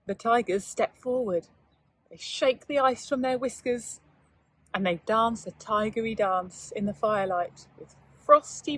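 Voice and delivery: slow, hushed storybook reading; gentle bedtime-story telling; story narration. A narrator's reading. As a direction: draw young listeners into the tale. Narration of the dance.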